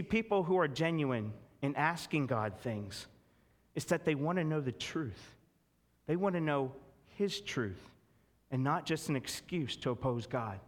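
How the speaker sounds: ordinary talk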